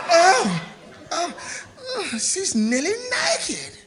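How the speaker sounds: high, slow amazed gasp; astonished gasp. Acted. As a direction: gawk at the sight. Lookout gawking.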